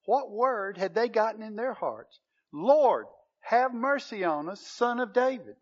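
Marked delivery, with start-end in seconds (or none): 2.5-5.6 loud plea, Lord called out hard; crying out. Retold or imitated. Blind men by the road crying out to Jesus, loud plea. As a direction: call out to someone passing by. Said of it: "cried out".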